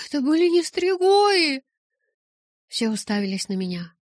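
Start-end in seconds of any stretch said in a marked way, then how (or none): 0.1-1.6 shocked shout. Acted. Rose protests guards' wrong assumption.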